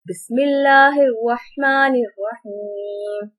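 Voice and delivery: slow, reverent Quranic recitation. Real, not acted. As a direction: intone the opening blessing slowly. Opening recitation before lesson.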